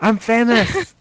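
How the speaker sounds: high, loud put-on squeal; mock starstruck boast. Friend teasing about being recognised, mock excited. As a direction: squeal it as a pretend celebrity. Mocking the fame story.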